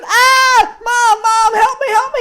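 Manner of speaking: high, loud panicked yell; screaming for help. A narrator's reading. Girl chased by goat, screaming to Mom for help. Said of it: "she's yelling, screaming".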